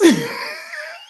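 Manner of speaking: high shrieking burst of laughter; a real laughing shriek; laughing scream. Real, not acted. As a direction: caught off guard by a funny name, shrieking laugh. Cries out at Z's name.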